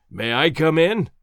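loud, slow boom. A narrator's reading. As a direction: boom it out slowly from the veranda. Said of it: "boomed a voice from the veranda".

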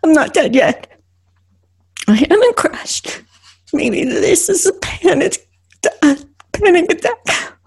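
high, halting, breathless fright; fearful realisation. Acted. Driver realises it is a panic attack, fearful realisation. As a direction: piece it together haltingly after terror.

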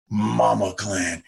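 low, very slow Dredd growl impression; Karl Urban's Dredd. Co-host imitating Karl Urban in Dredd, low and slow. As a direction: drop deep and drag the words out. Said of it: "He says it like that".

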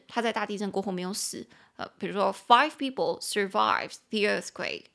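usual speaking voice

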